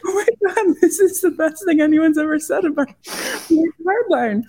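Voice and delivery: high, gushing delight at the compliment; excited gush. Retold or imitated. Designer gushing over a stranger's praise, high and thrilled. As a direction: squeal it like the best news ever. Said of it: "And I'm like".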